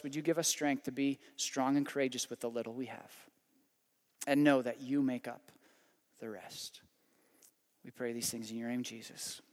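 soft, quiet prayer voice; closing prayer. Acted. Pastor praying over the congregation, soft and quiet. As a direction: pray gently over the congregation.

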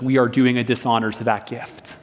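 ordinary talk

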